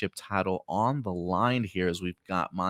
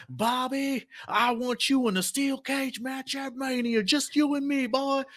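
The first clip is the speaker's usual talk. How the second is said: much higher put-on Montana-style drawl throughout; Brock Lesnar impression. Brock Lesnar on Raw calling out Bobby, high mock Montana accent. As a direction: ham up a Wyoming or Montana cowboy accent for a challenge. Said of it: "in his Wyoming or Montana accent".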